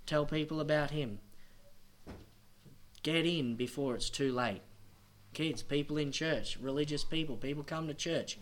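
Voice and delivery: loud, high, driving sermon voice; full-force preaching. Acted. Preacher warning churchgoers they will be accountable, fiery preaching. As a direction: hammer the warning home to the church.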